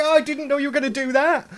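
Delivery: high, loud surprised exclamation. Acted. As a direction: act suddenly caught off guard. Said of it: "You're like".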